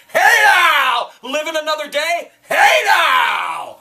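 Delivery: very loud, high hype yell; catchphrase shout. Real, not acted. Motivating viewers to keep living, loud high yell. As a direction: belt it to fire up the crowd.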